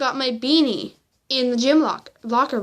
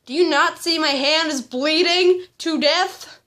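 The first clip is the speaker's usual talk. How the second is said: louder, slow incredulous retort; retold indignation; 4 semitones higher, 4 dB louder than usual. Injured student to classmate who stepped on her hand, indignant. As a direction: spell it out, exasperated and higher.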